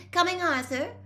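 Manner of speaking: called out high and loud to another room; a wife answering from the next room; calling out. A narrator's reading. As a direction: call to your husband in the kitchen. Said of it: "called Missus Weasley".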